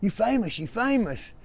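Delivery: excited friends. Retold or imitated. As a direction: mimic friends shouting the big news. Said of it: "they were like".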